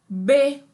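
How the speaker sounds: "B." said loudly and clearly, as the syllable be; a teacher calling out "B." loud for the class; syllable sounded out. Shown as a demonstration. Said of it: "a letra B com E forma B". (sílaba "B." dita bem alto e clara)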